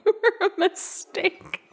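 choking with laughter; words squeezed out while laughing. Real, not acted. Laughing repeat of line.

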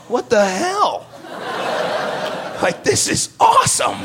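high, shouted joy; thrilled disbelief. Real, not acted. Seeing the third-row seats, a high thrilled shout. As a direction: let the excitement burst out.

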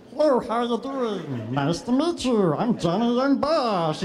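high, slow, sing-song hello; goofy monster voice. Retold or imitated. Actor clowning for cast members on set in a goofy voice. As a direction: goof around in the chicken monster voice. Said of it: "I'm being a goofball".

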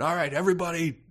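high, breezy and offhand; casual alien overlord. Pretend. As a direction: toss it off like a careless conqueror. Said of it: "you pointed your death ray and you went".

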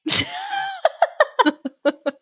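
long high-pitched burst of laughter. Real, not acted. Laughing at squishing.

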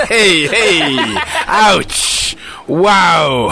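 loud, high yelps through laughter; laughing exclamations. Real, not acted. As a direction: laugh out the words. Players cracking up at a joke.

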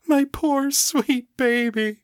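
put-on high tearful cry over her child. A narrator's reading. Mother hugging her lost son, tearful cry. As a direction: weep the words as you hold him. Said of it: "Margaret cried".

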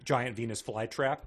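ordinary delivery

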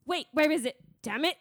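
hammed-up tantrum in an untrained put-on accent; Severo. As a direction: rant indignantly, building to a high yell. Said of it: "[name]'s gonna be our Severo for this".

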